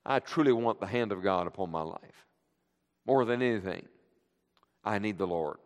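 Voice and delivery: soft, low, sincere confession; tender sincerity. Real, not acted. Preacher confessing to the congregation his longing for God, soft and low. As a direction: confide it gently, almost prayerful.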